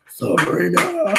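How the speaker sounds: slow, drawn-out demonic growl. Retold or imitated. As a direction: say the name like a demon.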